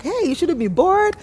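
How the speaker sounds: high, bright mock scold; playful scolding. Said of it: "And I'm like".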